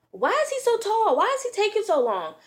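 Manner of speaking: high-pitched imitation of a fed-up friend; imitating an impatient friend's high wail; exasperated complaint. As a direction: whine it way up high, mock-exasperated. Heated friend complaining, retold.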